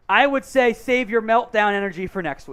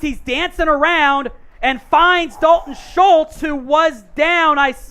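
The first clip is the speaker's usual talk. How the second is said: live play-by-play; 4 semitones higher, 4 dB louder than usual. Real, not acted. Host calls a scramble and completion live, play-by-play. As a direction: follow the quarterback with rising energy, stretching the key words.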